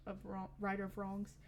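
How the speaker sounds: ordinary talk, nothing marked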